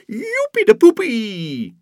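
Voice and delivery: very high, loud squeal of joy; delighted child's whoop; overjoyed cheer. Acted. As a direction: squeal it, arms in the air. Birthday joy.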